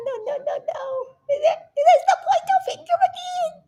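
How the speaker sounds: very high, squeaky laughing voice; laughing mockery. Real, not acted. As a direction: talk through high-pitched giggles, teasing. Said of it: "I say that with a smile".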